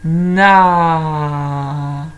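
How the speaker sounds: "Na" loud with a very long aaa; "Na" drawn out as nnaaaa; stretched vowel. Shown as a demonstration. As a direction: open "Na" out and hold the a. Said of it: "qu'est-ce qu'on entend?".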